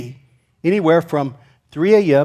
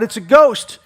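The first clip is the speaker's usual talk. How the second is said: raised, higher mock cry of alarm; louder retold yell, punched on the last word; 5 semitones higher, 6 dB louder, slower than usual. Preacher retelling the disciples' sighting, mock alarmed yell. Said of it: "and they yell out".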